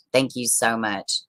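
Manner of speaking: quiet heartfelt thank-you repeated slowly. Real, not acted. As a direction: say it again gently, really meaning it.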